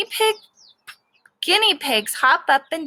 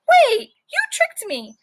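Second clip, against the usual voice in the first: high, slow squeaky realization; very high dawning surprise in a tiny voice; tricked guinea pig; 12 semitones higher, 5 dB louder, slower than usual. Acted. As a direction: squeak it out, suddenly caught.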